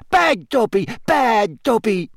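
high, self-punishing elf squeal. A narrator's reading. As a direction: squeal while scolding yourself.

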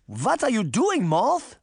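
high-pitched, urgent demand; old woman crying out, pitched high; alarmed old woman's cry. A narrator's reading. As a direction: cry out in alarm at a wayward companion. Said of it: "the old woman cried".

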